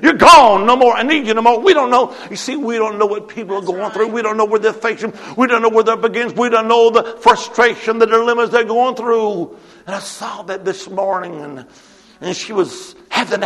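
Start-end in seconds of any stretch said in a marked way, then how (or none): 0.0-1.6 loud, higher dismissal; boss firing someone. Retold or imitated. Boss sacking a woman after her shift, loud dismissal. 1.6-9.6 loud, higher, driving sermon voice; impassioned preaching. Real, not acted. Preacher urging the church to understand people's struggles, impassioned.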